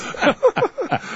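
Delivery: shrill laughing burst; high squeal laugh. Real, not acted. Cohost laughing before his own eclipse story.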